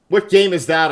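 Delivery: mock quote. Repeats his question to chat in a mocking voice. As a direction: throw his own question back, teasing.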